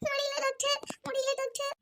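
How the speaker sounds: high, soft moaning plea; exhausted labour pain. Acted. Labouring woman begs the doctor, spent and hurting. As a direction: groan that you can't go on. Patient cannot bear labour.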